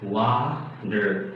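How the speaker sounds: "water" with T turned to D; soft, low "wa-der" for "water"; flapped T. Shown as a demonstration. Teacher demonstrating the T rule, flapped T in "water". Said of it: "this T is becoming D".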